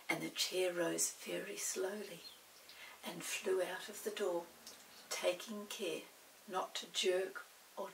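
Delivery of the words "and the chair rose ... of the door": ordinary delivery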